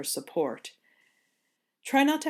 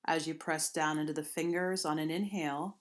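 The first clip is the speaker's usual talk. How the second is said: unhurried, gentle meditation guidance; guided meditation; 4 dB quieter than usual. Acted. Yoga teacher guiding listeners into seated movements, calm guided meditation. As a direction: ease them into the first stretch.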